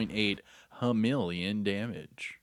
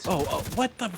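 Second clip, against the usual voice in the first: much higher, caught off guard; real startled alarm; 9 semitones higher, 4 dB louder than usual. Real, not acted. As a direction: yelp it as if ambushed. Ambushed from behind in game.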